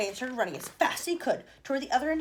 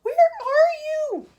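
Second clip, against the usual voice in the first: very high, loud, slow wail; anguished cry; 14 dB louder, much slower than usual. A narrator's reading. Mother wailing for her vanished son. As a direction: call out in anguish. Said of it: "screamed Missus T V".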